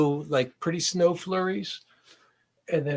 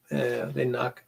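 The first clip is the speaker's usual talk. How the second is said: ordinary talk, nothing marked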